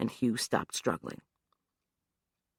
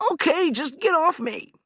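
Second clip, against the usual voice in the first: impatient; 4 dB louder than usual. Acted. Hugh impatient.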